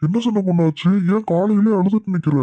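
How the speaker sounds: high-pitched cooing to an upset wife; fussing over a crying sweetheart, pitched high; doting coaxing. Acted. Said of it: "நீ சின்ன பொண்ணு செல்ல கண்ணுன்னு கொஞ்சினது".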